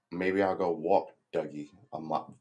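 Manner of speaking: normal talking voice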